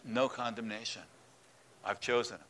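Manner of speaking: low, soft and firm; God calmly overruling the accuser; quiet voice of God. Acted. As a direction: settle it gently but with authority.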